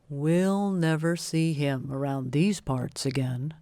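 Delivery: a lightly voiced, calm panther; Bagheera. Bagheera assures friends the tiger is gone, calm and lightly voiced. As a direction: reassure them the danger is gone, lightly voiced. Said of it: "said Bagheera".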